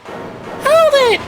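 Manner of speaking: very high squeaky Edgeworth. Acted. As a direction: squeak out the objection. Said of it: "I gave him the squeaky voice".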